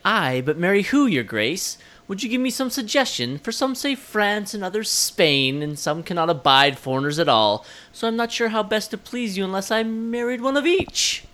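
a playful hint of Elizabeth mocking her suitors; light mock royal wit; imitating Cate Blanchett. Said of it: "continue imitating Cate Blanchett".

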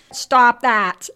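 lightly imitated little froggy voice; a loud, mildly croaky boy's command; Henry Spofford. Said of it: "with, like, his little froggy voice".

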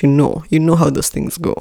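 ordinary talk

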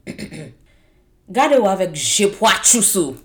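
loud, drawn-out Creole insult; rude, fighting emphasis. Shown as a demonstration. Showing friends how Creole words can sound rude, combative. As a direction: make harmless Creole sound like fighting words, punching "poitrous". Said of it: "if you speak it with a right emphasis on the right syllables, it makes any word sound".

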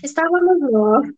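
nervous giggle on the words; half-laughing restart. Real, not acted. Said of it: "risada de nervoso". (rindo de nervoso)

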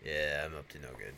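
low, quiet scheming tone; a villain lurking in the background; lurking werewolf admitting mischief; sneaky low voice. Retold or imitated. Louis hiding, voiced villain.

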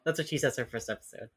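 normal talking voice

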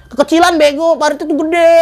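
high-pitched imitated telling-off; mock a bossy friend's scolding; angry scolding. Leader scolding him over the trench, imitated anger. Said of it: "dimarah-marahin gue".

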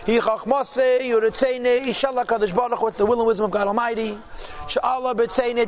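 ordinary talk

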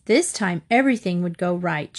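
plain reading voice

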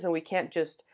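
usual speaking voice

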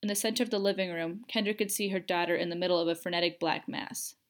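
plain reading voice

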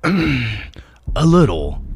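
very slow hoarse-sounding granny; wolf faking a cold. A narrator's reading. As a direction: drag it out like a sick old woman. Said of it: "pretending to cough".

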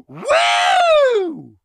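shrieked high woo, arms up; mock party woo. Comic imitating a heckler's piercing woo. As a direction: imitate the drunk lawyer's woo. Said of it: "somebody goes".

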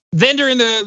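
usual speaking voice

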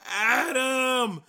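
high loud dramatic call; desperate shout. Retold or imitated. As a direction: cry the name out in despair.

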